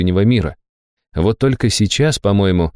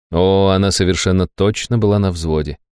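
plain reading voice

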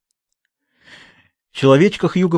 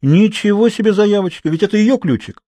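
put-on high-pitched, affronted girl; indignant; 7 semitones higher than usual. A narrator's reading. Girl cross that he wants no witnesses, indignant. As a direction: object hotly, pitched well up. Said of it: "Изабель сердито посмотрела на Хьюго".

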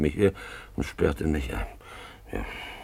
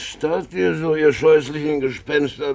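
mocking jeer; 7 semitones higher, 4 dB louder than usual. Drunk man mocking visitors.

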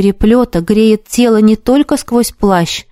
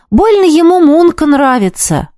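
put-on boy voice; 8 semitones higher than usual. A narrator's reading. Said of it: "продолжал мальчик".

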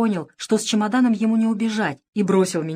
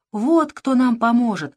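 slow, higher exclamation of relief; hope bursting out; exclaiming; 5 semitones higher, 4 dB louder, slower than usual. A narrator's reading. Queen exclaims to Pashka about rescue, slow and higher. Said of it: "воскликнула королева".

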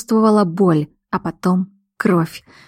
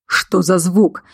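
mildly menacing question; menacing bark; slower than usual. A narrator's reading. Man hears a noise outside, menacing. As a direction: demand it with understated threat. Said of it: "угрожающе рявкнул мужчина".

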